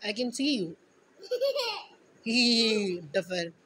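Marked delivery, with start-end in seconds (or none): 1.3-1.8 a short high giggle breaking through the scolding; high burst of laughter. Real, not acted. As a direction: laugh despite trying to stay stern.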